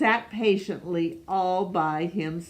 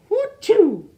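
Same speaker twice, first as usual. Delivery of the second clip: high, loud sneeze sound; mock sneeze; 13 semitones higher, 8 dB louder than usual. Sick Amos sneezing in the morning, a loud high sneeze. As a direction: burst out a big sneeze.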